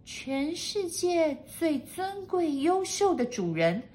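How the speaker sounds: high, slow maid's repetition; high maid voice, reciting slowly; echoing maid. A narrator's reading. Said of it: "跟着念了一次".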